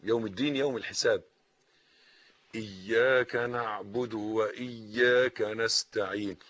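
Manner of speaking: usual speaking voice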